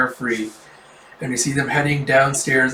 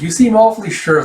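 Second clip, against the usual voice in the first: high, louder teasing remark; teasing challenge; 7 semitones higher, 5 dB louder than usual. Acted. Companion doubting the Thunder Beast, teasing challenge. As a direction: needle them with raised pitch.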